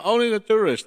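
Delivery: high, loud amused echo; a loud incredulous chuckle of a question; amused surprise. Real, not acted. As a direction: repeat it back, half laughing at the joke. Amused echo of partner's claim.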